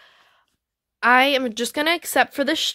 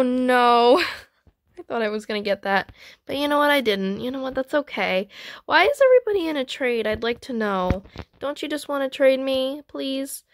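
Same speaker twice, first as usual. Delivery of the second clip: ordinary talk, nothing marked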